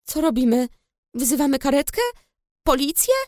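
mildly broken, rising panicked questions; ask, close to tears. A narrator's reading. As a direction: sister asking what to do, voice breaking a little. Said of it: "zapytała jego siostra załamanym głosem".

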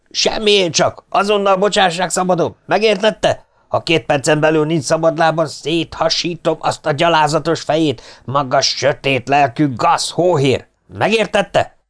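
high, furious officer's threats; shouting in rage. A narrator's reading. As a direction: cut in and threaten him furiously. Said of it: "senki sem hallotta az őrnagyot hangosan beszélni".

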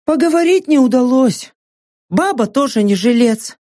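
mocking mercenary woman. Saka's voice.

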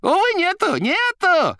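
high, loud rattling cry; shouting. A narrator's reading. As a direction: shout it down the line. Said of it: "кричала трубка".

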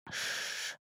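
soft unvoiced soothing shush. A narrator's reading. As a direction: hush her gently. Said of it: "успокаивает он".

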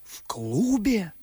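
loud, slow incredulous question; loud stunned question; astonished. Acted. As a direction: gasp it out in disbelief. Astonished question about the club.

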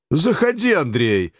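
high hearty welcome call; jovial commandant. A narrator's reading. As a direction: boom out a friendly welcome. Commandant invites narrator in.

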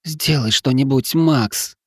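high, slow moaning plea; pained plea. Acted. Dying wife begs her husband to help, moaning. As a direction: beg through the pain, drawn out. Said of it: "простонала женщина".

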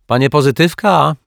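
loud hail across the yard; calling out. A narrator's reading. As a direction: call to him from the third floor. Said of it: "krzyknęła pani Ryczaj".

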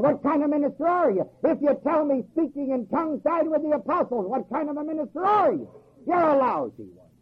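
rising, insistent pulpit challenge; fiery preaching. Real, not acted. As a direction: drive each question home like a revival preacher. Preaching at full force.

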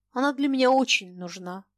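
ordinary delivery, nothing marked

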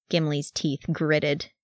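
ordinary delivery

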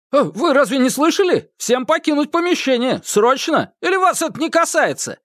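panicked museum staffer urging; urgent staffer. Acted. Сотрудник торопит к выходу. (встревоженный сотрудник музея)

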